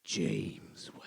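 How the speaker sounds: whispered, low, drawn-out reveal; slow and dramatic. Acted. [name] delivering the new punchline, dramatic whisper. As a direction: breathe the name out in a dramatic whisper. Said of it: "So I did what he said".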